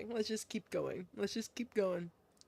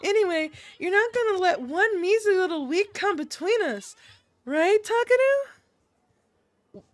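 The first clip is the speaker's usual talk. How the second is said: higher voice, long rising plea; wheedling girl character; 6 semitones higher, 3 dB louder than usual. Acted. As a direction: coax him, stretching the final words high.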